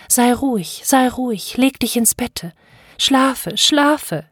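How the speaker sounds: high, urgent soothing; mother calling after. Acted. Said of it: "rief mir die Mutter nach". (beschwichtigend und hoch nachgerufen)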